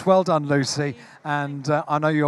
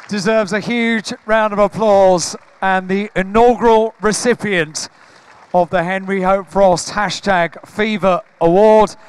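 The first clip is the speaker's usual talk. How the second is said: loud, raised ceremony announcer voice; award announcer; 4 semitones higher, 7 dB louder than usual. Real, not acted. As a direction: project it to the hall, calling the winner up for applause. Announces award winner on stage.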